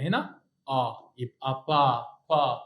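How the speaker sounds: slow, careful با with open آ; slow vowel demo. Shown as a demonstration. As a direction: spell out با slowly. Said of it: "تعال نطبق الكلام ده في أول كلمة". (نطق بطيء واضح لـ با)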